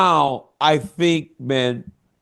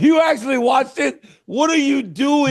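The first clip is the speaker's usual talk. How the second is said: incredulous; 8 semitones higher than usual. Real, not acted. Incredulous friend questioning.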